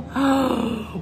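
sharp storyteller's gasp; dramatic gasp. Acted. Reader warns children of coming crash, dramatic gasp. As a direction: suck in air in suspense. Reader reacts to an extra monkey.

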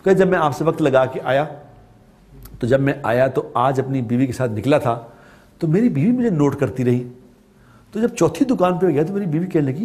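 ordinary talk

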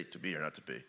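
throwaway plain reading; flat wrong way. Shown as a demonstration. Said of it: "You can't say".

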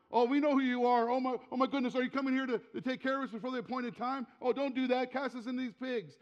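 high, loud, frantic mock pleading; hammed-up hysterics, words tumbling out in alarm; hysterical demons. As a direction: imitate panicked demons freaking out. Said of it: "They're hysterical".

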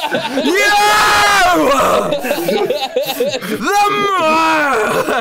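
laughing shout at Boss; furious shout. Acted. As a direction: shout it as he grabs for the sword. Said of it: "Trevor looks over at Boss".

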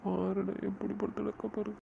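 weep as you look at her; breaking voice, sorry through tears; crying. Acted. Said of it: "டேய், அழாதடா".

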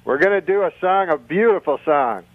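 usual speaking voice

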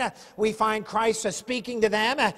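ordinary delivery

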